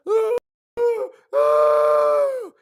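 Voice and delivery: put-on loud strained ooh of a pinned boy; pained ooh cries. Boy pinned by his father cries out, loud pained ooh. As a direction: cry out in pain, lifted off the ground. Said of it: "And I was like".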